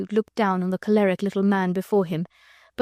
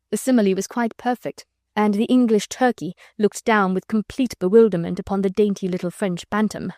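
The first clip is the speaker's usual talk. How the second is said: usual speaking voice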